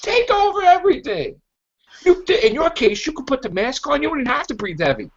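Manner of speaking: high-pitched mock villain voice, coaxing; hammed-up high tempter voice urging him on; mock Snoke. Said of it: "And then Snoke's like".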